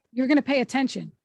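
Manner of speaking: ordinary talk, nothing marked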